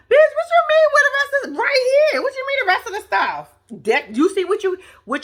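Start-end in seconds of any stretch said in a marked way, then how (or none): 0.1-3.5 high, loud, incredulous demand; indignant outburst. Pretend. Woman taking offense at question about the stuff, indignant. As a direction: fire the question back, outraged and high-pitched.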